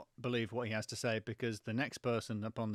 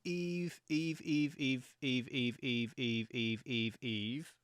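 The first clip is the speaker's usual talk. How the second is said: echoing announcement; much slower than usual. Pretend. Podcast host announces the play to the audience, fading echo. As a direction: stretch MERRY CHRISTMAS, then repeat Eve, fading away.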